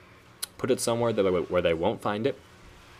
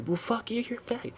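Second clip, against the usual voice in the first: high, squeaky mock of a raging kid; high mocking voice of a kid trolling on Xbox; mocking angry kid; 3 dB quieter than usual. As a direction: whine it like a bratty kid cursing at you. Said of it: "And if they're like".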